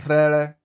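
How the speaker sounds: "rere" starting on a trilled r; rolled R example. Shown as a demonstration. Teacher giving the second example, rolled r in rere. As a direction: roll the first r of "rere". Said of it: "by rolling your tongue".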